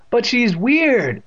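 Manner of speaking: high, drawn-out whine; protesting. A narrator's reading. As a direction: stretch the complaint as the boy. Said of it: "Evan protested".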